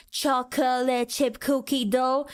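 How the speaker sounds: "Chocolate chip cookie dough" dragged out slowly; mock slow customers stretching each syllable of "Chocolate chip cookie dough"; laboured, slow order. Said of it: "a long line of people saying".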